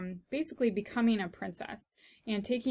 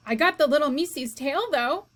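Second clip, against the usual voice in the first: higher, louder boast; proud; 5 semitones higher, 6 dB louder than usual. Acted. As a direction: brag happily. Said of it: "said Mig".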